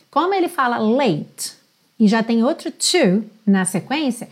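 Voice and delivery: ordinary talk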